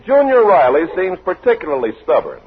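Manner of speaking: normal talking voice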